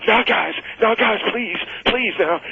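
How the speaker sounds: high, pleading plea to stop the fight; high pleading voice of a scared friend; pleading Tommy. Retold or imitated.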